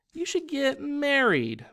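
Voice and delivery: put-on a child gently pushing grown-up advice; young girl voice. A narrator's reading. Child Myrtle urging Mister Martin.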